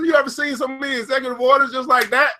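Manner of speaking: usual speaking voice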